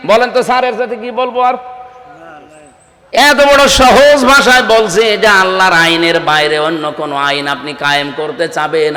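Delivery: ordinary talk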